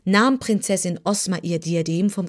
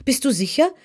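higher, startled disbelief; alarmed question; 6 semitones higher than usual. A narrator's reading. Ozma, alarmed, questions her maid with startled disbelief. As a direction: ask it sharp and incredulous. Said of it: "rief Ozma".

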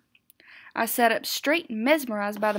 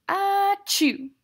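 sneeze; 10 semitones higher, 6 dB louder than usual. A narrator's reading. Sneeze from fur.

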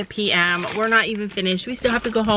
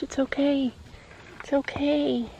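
soothing; 4 semitones higher, 5 dB quieter than usual. Real, not acted. Soothing the scared horse.

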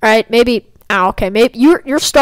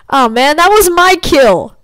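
loud, drawn-out complaint, pitched up; whiny protest; 5 semitones higher, 4 dB louder, slower than usual. Real, not acted. Gamer losing a kill to another player, whiny protest. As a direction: wail over being robbed, half playful.

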